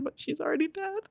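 high, small, soft squeak; trying not to cry at a death; real tearful voice. Real, not acted. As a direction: voice rising and thinning with tears. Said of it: "I'm gonna fucking cry again".

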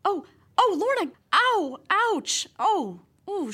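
high pained gasps of alarm; shocked yelps. Acted. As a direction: wince and yelp in shock. Reporter sees Lorna hurt.

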